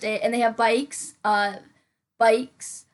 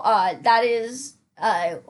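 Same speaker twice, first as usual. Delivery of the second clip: ordinary talk, nothing marked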